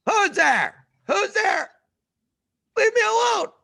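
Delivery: high loud shout, leave me alone; a grieving Egyptian yelling through the door; angry grieving neighbor. Retold or imitated. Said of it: "and he goes".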